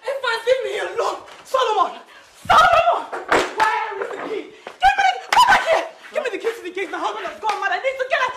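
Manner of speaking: very high, frantic cries for help; panicked screaming. Acted. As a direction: scream desperately for the key as you flee. Wife flees, begs for gate key.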